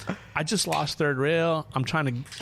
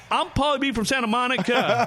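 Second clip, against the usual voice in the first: high, projected hype-man yell; hammed-up stage shout; stage announcement; 10 semitones higher, 4 dB louder than usual. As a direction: imitate a rapper introducing himself to a festival crowd. Acts out friend's stage introduction.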